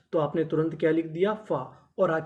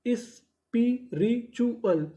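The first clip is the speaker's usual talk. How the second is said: Spiritual very slow and higher, broken into is-pi-ri-chu-al; syllable by syllable; 5 semitones higher, much slower than usual. Shown as a demonstration. Teacher joining the codes for learners, spiritual syllable by syllable. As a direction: sound out spiritual piece by piece with gaps. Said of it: "तो पूरी meaning".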